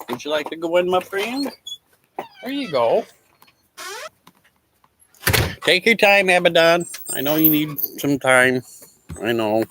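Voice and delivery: high sing-song voice for a pet; pet talk. Real, not acted. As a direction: coax a pet gently in a high croon. Sweet-talking her pet Abaddon.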